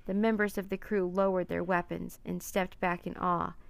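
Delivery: plain reading voice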